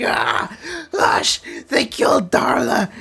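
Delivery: high wailing cry-shout, slowed at the end; imitating a grieving rage. The Master mourning Darla, wailing in a high crying shout. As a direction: wail it like a villain losing his beloved. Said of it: "the Master just losing it".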